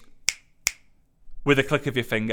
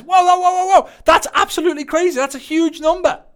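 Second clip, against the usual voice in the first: much higher, louder mock amazement at the comment count; amazed onlookers; 13 semitones higher, 6 dB louder than usual. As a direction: squeal it out like someone stunned by five hundred comments. Mimicking amazed viewers of comments.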